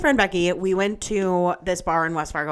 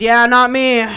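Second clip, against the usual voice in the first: loud, raised mock retort; imitating a dismissive man; 5 semitones higher, 9 dB louder, slower than usual. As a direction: mimic his blunt refusal, loud and higher. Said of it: "Literally how he said it".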